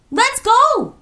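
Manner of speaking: very high, loud command; urgent yell. A narrator's reading. Kojo fleeing the troll with friends, urgent yell. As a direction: yell to get everyone running. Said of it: "yelled Kojo".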